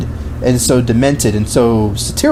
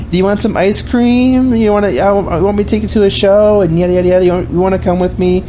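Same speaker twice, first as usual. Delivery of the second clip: mock doting mother; 7 semitones higher than usual. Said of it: "she's like".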